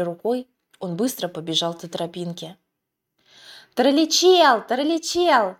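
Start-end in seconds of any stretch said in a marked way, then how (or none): 3.7-5.6 loud, high distant call; calling from afar. A narrator's reading. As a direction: shout from far away. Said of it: "как бы издалека донёсся голос". (зов издалека)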